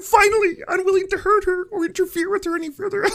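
Jordan Peterson. Acted. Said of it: "Why did [name] do that Kermit voice that well?".

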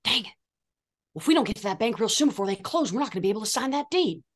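a dead-serious actor sticking to his lines; Matt Barr impression. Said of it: "best impression that anyone's done of another cast member".

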